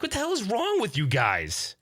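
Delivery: high, disbelieving outburst; can't believe they don't care; incredulous outburst. Retold or imitated. Dad to his bored kids, high and incredulous.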